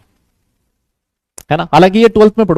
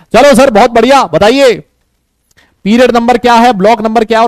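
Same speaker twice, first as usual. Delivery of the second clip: higher and louder, urging the class on; excited rally; 5 semitones higher, 5 dB louder than usual. Real, not acted. Teacher urging the students to answer, excited and loud. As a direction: pump the class up for quick answers.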